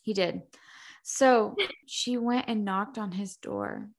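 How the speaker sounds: ordinary talk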